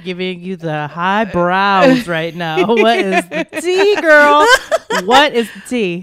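high, loud, laughing gossip voice; sassy gossip. Acted.

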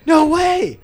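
high, loud, hammed-up disbelief; mock amazement. Kid amazed Papa Beal shares the favorite, high excited shout. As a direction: cry it out like a thrilled kid.

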